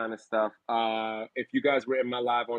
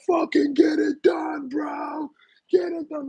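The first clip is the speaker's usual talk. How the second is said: hyped shout; slower than usual. Retold or imitated. Voicing tough women cheering.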